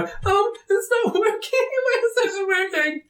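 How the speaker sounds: high, flustered with a nervous laugh; panicked young wizard. Acted.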